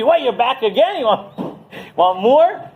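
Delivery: high, indignant retold snap; mock-annoyed greeting at the door; angry. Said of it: "all angry".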